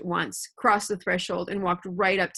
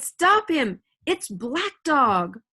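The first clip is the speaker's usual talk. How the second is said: put-on urgent boy's shout, much higher; cried out; 5 semitones higher, 4 dB louder, much slower than usual. A narrator's reading. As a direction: shout it after the fleeing man. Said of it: "I cried".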